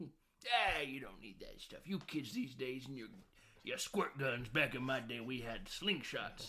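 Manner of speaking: hammed-up old-timer grumbling; grumpy grandpa. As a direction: grumble like grandpa dismissing kids these days. Improvised grumpy Grandpa rant.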